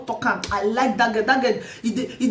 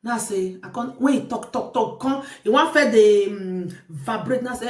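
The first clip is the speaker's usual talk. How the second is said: ordinary delivery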